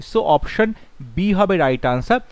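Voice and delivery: usual speaking voice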